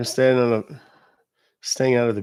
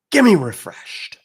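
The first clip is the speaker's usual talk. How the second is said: higher, louder, slower call; loud demand for refreshed; 6 semitones higher, 7 dB louder than usual. Shown as a demonstration. Host loudly calling chat to type refreshed. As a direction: belt it to the crowd. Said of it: "I wanna see the word refreshed".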